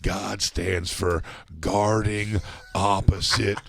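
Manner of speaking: slow, drawn-out oddball delivery; slow celebrity impression, words dragged apart; Gary Busey. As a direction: stretch every word out, half speed. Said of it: "I use it like Gary Busey".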